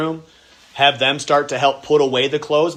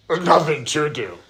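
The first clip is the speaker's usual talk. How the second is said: put-on bored kid. Kid repeating complaint.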